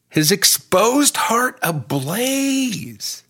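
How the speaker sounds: stretched out, way up high, in awe; awed high repeat. Acted. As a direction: sing it out slowly in wonder, pitch soaring.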